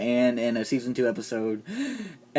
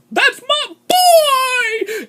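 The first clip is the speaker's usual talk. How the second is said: very high, drawn-out overacted wail; mocking Lori impression; 5 dB louder, much slower than usual. Reviewer imitating Lori's terrible acting, high and drawn out. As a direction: stretch it out in a hammed-up high cry. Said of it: "that's exactly how they said it".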